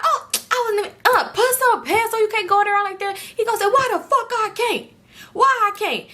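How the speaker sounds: high, put-on protesting voice; mock high-pitched bickering; squabbling uncle voice. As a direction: argue way up high, indignantly. Uncle and friend argue over pants.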